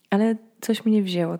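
ordinary delivery

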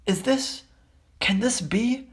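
high astonished question; amazed. Acted. Bartender amazed at Harry.

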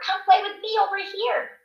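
put-on high, eager little-girl call; high-pitched playground invitation; child calling friends over. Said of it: "waving the kids over".

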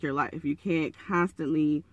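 ordinary talk, nothing marked

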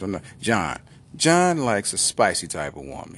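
ordinary talk

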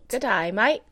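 broad Aussie vowels on "mate"; put-on Australian greeting; Australian accent. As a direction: echo it as a mock Australian. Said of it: "say something in Australian accent".

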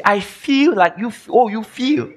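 mock whiner. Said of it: "And you say, you see".

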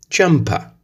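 Jumper without the r, ʒɐmpa; British jumper. Shown as a demonstration. Teacher models the British word. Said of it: "in the British English".

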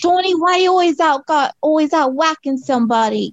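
high, hammed-up New York mobster; exaggerated New York mafioso accent; mafioso New Yorker. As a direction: complain like a movie gangster from New York. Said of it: "become, like, mafioso".

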